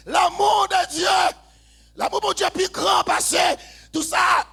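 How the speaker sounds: very high, shouted proclamation; impassioned, loud pulpit voice; full-force preaching. Real, not acted. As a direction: cry it out over the keyboard.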